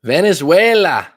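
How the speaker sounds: loud, high, drawn-out call; mock-rousing shout, slowed down; playful call-out. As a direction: belt out the country name playfully, pitch swooping up. Playfully calling out the country.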